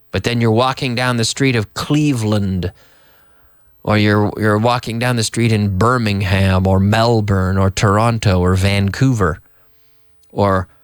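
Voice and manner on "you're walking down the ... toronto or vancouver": ordinary delivery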